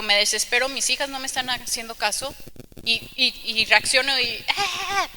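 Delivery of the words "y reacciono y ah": laughing mock scream at her girls; mock yell with laugh. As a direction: ham up a short shriek, laughing.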